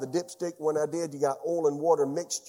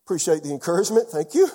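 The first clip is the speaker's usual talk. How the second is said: laughing sarcasm; 6 dB louder, faster than usual. Retold or imitated. Sarcastic reply to doctor.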